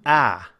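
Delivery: sustained open "ah"; ah vowel demo. Shown as a demonstration. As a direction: open "ah" as in cat, mouth wide. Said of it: "That is".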